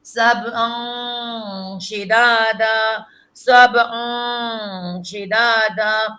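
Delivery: slow nasal drill of سبعا شدادا; nasal ghunna drill. Shown as a demonstration. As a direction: hum the نون through the nose between سبعا and شدادا. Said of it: "ناک میں".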